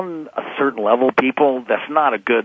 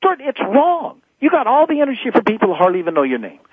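indignant; 9 semitones higher than usual. Real, not acted. Indignant outburst at spouse neglect.

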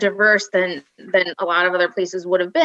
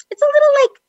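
much higher, prissy put-down voice; mocking high voice; 14 semitones higher than usual. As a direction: wrinkle your nose at the place in a high voice. Mocking the boyfriend's college.

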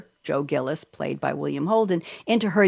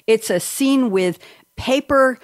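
ordinary talk

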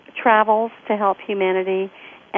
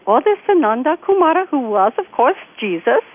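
lilting, animated imitation Indian accent; 5 semitones higher, 5 dB louder than usual. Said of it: "Great Indian accent".

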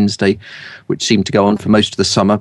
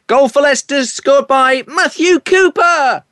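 goal commentary voice; slower than usual. Shown as a demonstration. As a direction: belt it like a stadium goal announcement. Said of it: "I'd say".

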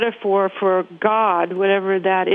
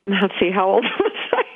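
breaking into a laugh at herself; real laugh bubbling up through the question; amused self-questioning; 3 semitones higher, faster than usual. Real, not acted. Guest forgets her own age while telling her story, amused and laughing.

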